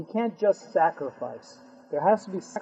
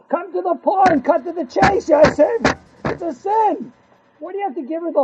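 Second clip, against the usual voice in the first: higher, louder, impatient outburst; exasperated; 11 semitones higher, 5 dB louder than usual. Acted. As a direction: cut to the chase, fed up. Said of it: "exasperated rebuke of Yosef".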